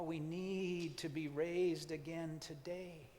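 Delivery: hushed reverent prayer. Acted. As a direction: pray it gently, almost to yourself. Prayerful meditation to God.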